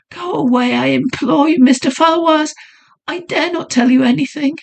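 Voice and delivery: high, begging lady; imploring young woman. Acted. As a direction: plead with him to go. Heroine begs him to leave.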